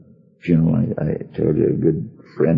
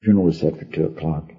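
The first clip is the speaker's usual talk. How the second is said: ordinary delivery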